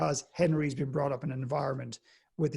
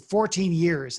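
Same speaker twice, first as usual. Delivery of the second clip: louder, irritated repeat; getting angry; 4 semitones higher, 8 dB louder, much slower than usual. Retold or imitated. Audience member repeating his answer to the speaker, louder and irritated. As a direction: snap it back, fed up. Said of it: "now he was getting angry".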